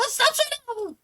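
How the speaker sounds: high squeaky gremlin yelp. Retold or imitated. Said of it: "I said".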